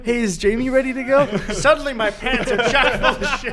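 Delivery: repeating it through high loud laughter; cracking up mid-line; laughing. Real, not acted.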